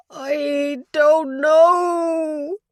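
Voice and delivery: put-on old father wailing from his hospital bed. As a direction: draw it out into a cry of grief. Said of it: "It came out as a wail".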